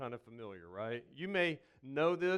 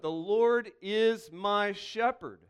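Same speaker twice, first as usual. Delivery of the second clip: slow, louder, each word weighed; slow reverent recital; 5 semitones higher, 8 dB louder, slower than usual. Shown as a demonstration. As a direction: let the words land slowly and firmly. Quoting the psalm with reverence.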